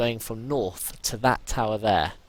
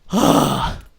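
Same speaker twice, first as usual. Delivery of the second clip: pained cry; 12 dB louder than usual. Real, not acted. Injured, zombies attacking.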